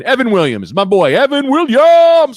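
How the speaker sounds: high, loud, slow announcer call; hype guest intro. Acted. Host announcing his friend, drawn-out hype call. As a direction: bring him in like a ring announcer.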